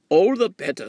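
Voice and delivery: booming groundhog call; loud groundhog. Acted. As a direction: call it out boldly. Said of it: "said the groundhog".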